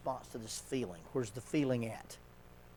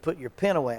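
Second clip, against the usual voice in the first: louder mock order; imitating client; 3 semitones higher, 10 dB louder than usual. Client told to order the counselor, louder mock command. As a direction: give the order, half in jest. Client's line in pen demo.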